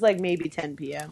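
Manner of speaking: ordinary talk, nothing marked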